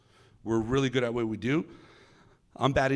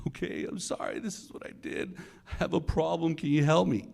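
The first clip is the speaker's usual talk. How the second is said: imitated crying confession; 5 semitones higher than usual. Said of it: "He starts crying".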